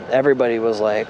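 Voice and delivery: plain reading voice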